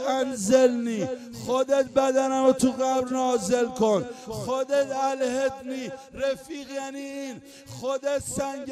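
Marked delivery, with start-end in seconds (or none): none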